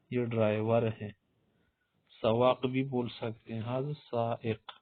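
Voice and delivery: ordinary delivery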